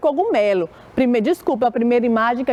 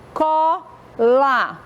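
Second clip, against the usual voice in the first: "Cola" drawn out as co... la; syllable by syllable; 2 semitones higher than usual. Shown as a demonstration. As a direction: count out both syllables of "Cola". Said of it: "fez movimento com a boca".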